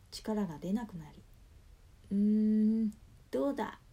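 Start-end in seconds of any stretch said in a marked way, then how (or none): 2.1-3.8 loud strained heave and throw; straining grunt. Acted. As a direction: grunt with effort as you throw. Poverty god throws fortune god.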